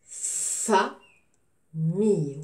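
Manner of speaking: very slow FA, pause, MILLE; teacher sounding out "famille" in stretched pieces; syllable by syllable. Shown as a demonstration. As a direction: split "famille" into two long, separate beats. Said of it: "je découpe le mot".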